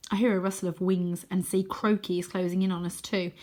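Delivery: ordinary delivery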